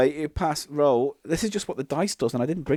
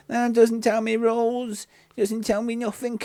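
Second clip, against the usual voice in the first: put-on silly voice; 8 semitones higher, 4 dB louder than usual. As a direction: whine it in a high put-on voice, building louder. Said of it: "quite like this voice".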